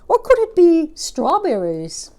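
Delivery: high excited roly-poly bird. Acted. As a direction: squeak it out like a small round bird. Narrator voicing the roly-poly bird.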